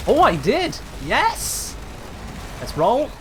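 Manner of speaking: high excited cheer; triumphant yes. Real, not acted. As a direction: cheer the lucky throw. Successful throw.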